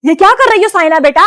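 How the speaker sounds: loud sharp call to her daughter; mother calling out. Acted. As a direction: call out loudly, catching her in the act. Mother catches daughter practising. (ज़ोर से पुकारती माँ)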